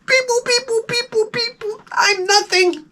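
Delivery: very high, fading beep boop robot. Retold or imitated. Mute school girl's handwriting voiced as a high tearful robot. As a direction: beep like a tiny sad machine, then a crying "I'm nothing". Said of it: "she writes her name and it's like".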